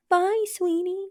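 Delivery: high, drawn-out imitation of a dad's sweet farewell; doting dad goodbye. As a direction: coo it like a parent to a sleeping child. Imitates dad's goodbye to sleeping child.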